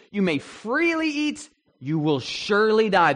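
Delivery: "freely" lifted high and slow, "surely" punched loud; stress on freely and surely. Shown as a demonstration. Teacher sums up God's command, stressing "freely" and "surely". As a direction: hit both "freely" and "surely". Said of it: "two points of emphasis".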